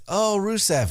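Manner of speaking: hammy villain calling out to Rusev; mock Jinder greeting. Jinder calling out to Rusev, mock eager. Jinder voiced in wrestling recap.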